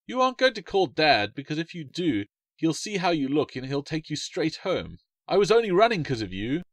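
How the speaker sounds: put-on high, pouty kid voice; bickering child. A narrator's reading. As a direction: argue like a cross little sister. Children bickering, quoted dialogue.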